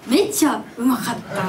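trying Osaka dialect. Shown as a demonstration. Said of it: "どうやって言うの？ 大阪弁で".

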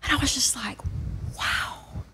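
hushed, barely voiced realization; very soft, taken aback; stunned whisper. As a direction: let it out in a whisper. Stunned at hearing herself.

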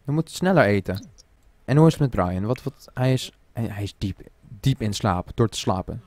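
normal talking voice

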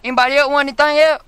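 loud, fast call to the back seat. Real, not acted. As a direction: shout back to the passengers. Calling out to friends in car.

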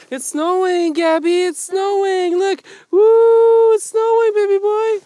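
squealy dog-parent voice with a woo; excited pet talk. Real, not acted. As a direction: gush at your puppy about the snow. Cooing at the dog in snow.

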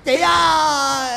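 slow, drawn-out crying call; mock sobbing wail. Hired mourner crying to the hostess, slow mock sob. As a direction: drag out the cry like a hired mourner.

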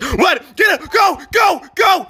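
high-pitched shouted commands; urgent shout. Real, not acted. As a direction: shout it high, urging teammates to run. Urgent orders in a game.

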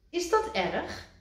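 high, anxious; worried Kikker. A narrator's reading. Kikker asking Rad, high anxious. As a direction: ask nervously.

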